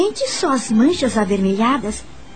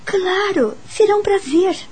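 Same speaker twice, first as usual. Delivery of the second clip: eager, higher-pitched yes; pleased and obliging, pitched up; delighted acceptance; 8 semitones higher than usual. Acted. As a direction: accept the invitation with lifted delight. Delighted acceptance of tea invitation.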